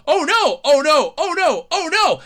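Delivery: hammed-up oh no; mock panic. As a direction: play a helpless bystander. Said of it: "somebody keeps going".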